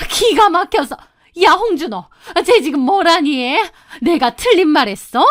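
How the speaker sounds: high-pitched outraged mother-in-law; indignant yelling. A narrator's reading. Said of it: "악을 썼습니다". (악을 쓰는 시어머니 목소리)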